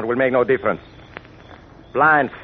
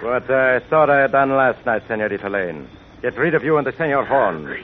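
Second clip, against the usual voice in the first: put-on menacing villain with a Spanish accent. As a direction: threaten them coldly, Spanish accent.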